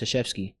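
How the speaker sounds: "Krzyzewski" as "shuh-SHEF-skee"; surprising name pronunciation. Shown as a demonstration. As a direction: say "Krzyzewski" starting on an S-like sound, no hard K. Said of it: "is pronounced".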